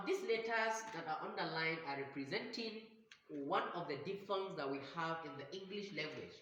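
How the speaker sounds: ordinary talk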